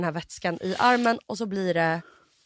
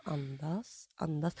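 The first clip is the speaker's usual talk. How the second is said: much softer, low self-soothing murmur; calming breath cue; 6 semitones lower, 13 dB quieter, much slower than usual. Retold or imitated. In the scanner, telling herself to breathe, softly. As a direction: talk yourself down gently, under your breath.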